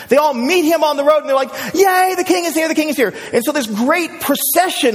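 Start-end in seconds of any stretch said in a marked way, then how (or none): 1.7-3.1 high-pitched acted cheer; thrilled villager's cry, pitched high; excited cheering. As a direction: cheer the king's arrival. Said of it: "yelling and screaming".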